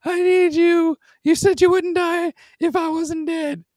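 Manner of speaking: put-on very high, loud sobbing girl voice; hammed-up falsetto pleading; mock crying girl. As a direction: wail for your dying brother. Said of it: "she's like".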